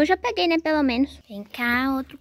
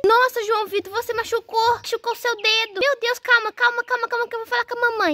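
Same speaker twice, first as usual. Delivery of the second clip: very high, alarmed voice; panicked alarm; 10 semitones higher than usual. Acted. Sister sees brother's cut finger, panicked. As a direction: panic and rush to get help.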